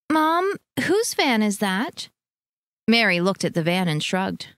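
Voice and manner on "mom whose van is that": put-on child's question. A narrator's reading. Child asking about the van.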